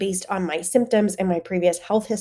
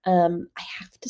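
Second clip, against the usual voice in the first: real breathy whisper, voice giving out; sick voice fading to air; 4 dB quieter than usual. Real, not acted. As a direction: speak with almost no voice, out of breath. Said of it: "sounding like breathy whispers".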